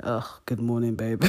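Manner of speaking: a hint of a sleepy guy's low morning voice. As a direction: lightly imitate a guy greeting his girl on waking. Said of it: "that morning voice".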